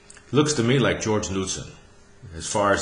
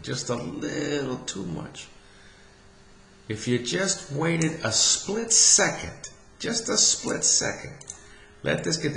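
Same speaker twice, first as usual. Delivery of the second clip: higher, slow, drawn-out "split second"; pleading coach; 6 semitones higher, slower than usual. Acted. Coach pleading with Rocco to wait a split second, higher and slow. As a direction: plead gently with the golfer to wait.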